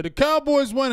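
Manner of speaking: high, loud whoop of triumph; mock victory cry. Fan imagining the win as he dies at eighty, mock-jubilant. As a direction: jump way up in pitch, hammed-up jubilation.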